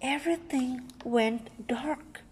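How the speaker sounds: plain reading voice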